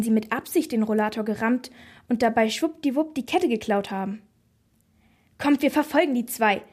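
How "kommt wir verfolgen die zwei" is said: higher, louder rallying call; eager brother rallying the gang; urging on. A narrator's reading. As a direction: spur the others into a chase. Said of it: "trieb uns mein Bruder Schorsch an".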